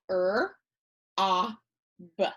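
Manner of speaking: "er, ah, b" as three separate letter sounds; sounding out. Shown as a demonstration. Teacher sounding out a word for pupils, "er, ah, b" separately. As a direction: segment "er, ah, b" with pauses between. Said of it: "the O makes a short sound".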